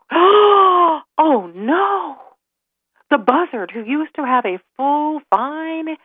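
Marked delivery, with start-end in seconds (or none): 0.1-2.2 high, stretched-out oh no; high-pitched dramatic dismay; dismayed cry. A narrator's reading. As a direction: gasp it out in storybook alarm. Narrator reacts to burning.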